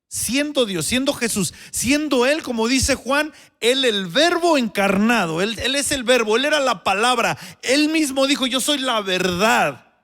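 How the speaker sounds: predicando con fuerza, más agudo y más fuerte; predicación intensa. Acted. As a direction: eleva la voz como predicador en el púlpito. Sermon building to full force. (predicador con fervor creciente)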